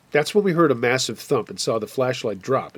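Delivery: usual speaking voice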